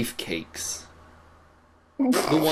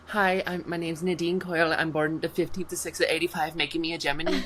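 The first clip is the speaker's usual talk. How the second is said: put-on Irish accent, slightly higher voice; 4 semitones higher than usual. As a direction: introduce yourself in a lilting Northern Irish accent. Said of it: "how she gets her accent".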